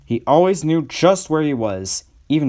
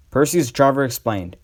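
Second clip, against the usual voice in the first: usual speaking voice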